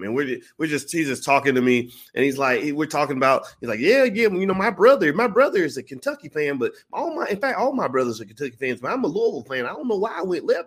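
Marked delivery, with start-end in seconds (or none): 3.8-10.6 put-on higher, chatty old fan from Kentucky; old Kentucky man. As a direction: play the old driver rambling about his brothers. Old man voiced in story.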